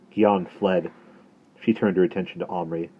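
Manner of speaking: ordinary delivery, nothing marked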